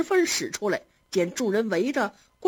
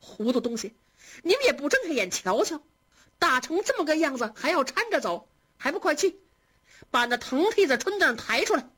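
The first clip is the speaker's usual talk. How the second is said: higher, fast, exasperated berating of the maids; angry scolding; 7 semitones higher than usual. A narrator's reading. Xifeng scolds the careless maids, hurried and angry. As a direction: snap at the servants to hurry. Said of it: "气得凤姐就骂".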